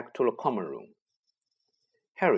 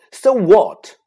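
much higher and much louder, a defiant shout; 10 semitones higher, 16 dB louder than usual. A narrator's reading. Harry shouting at his friends: defiant shout. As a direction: fling it back at them furiously. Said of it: "Harry shouted".

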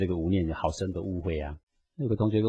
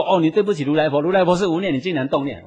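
louder, higher mock scold of a critic; imitating an indignant critic, raised voice; mock accusing scold; 8 semitones higher, 6 dB louder than usual. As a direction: play the accuser wagging a finger, half in jest. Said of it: "你说".

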